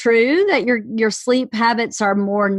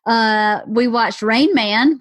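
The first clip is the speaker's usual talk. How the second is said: usual speaking voice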